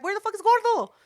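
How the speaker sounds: put-on calling out for the missing kid; alarmed call. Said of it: "and we're like".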